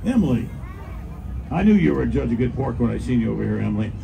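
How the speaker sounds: normal talking voice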